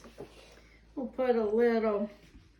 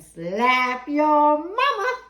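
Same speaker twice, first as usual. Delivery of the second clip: drawn out and punched louder; playful emphasis; 3 semitones higher, 8 dB louder, much slower than usual. Acted. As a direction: sell the seasoning name with flair. Seasoning brand name, drawn out.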